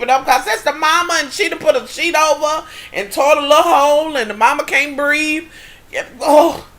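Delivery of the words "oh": ordinary talk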